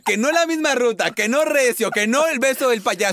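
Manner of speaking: high teasing complaint voice; mock her fussy rules; mocking whiny imitation. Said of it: "pero qué quisquillosa".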